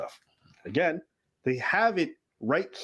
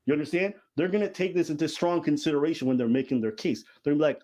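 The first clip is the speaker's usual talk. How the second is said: normal talking voice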